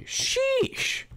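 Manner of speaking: sudden high yelp of pain. Acted. Hades yelping as baby Hercules squeezes his fingers. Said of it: "making Hades shout and pull away".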